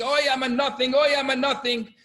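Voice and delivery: high, loud wailing mock scream; imitated Yiddish screaming. Student in the study hall late at night, imitated screaming. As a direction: wail it out across a hall.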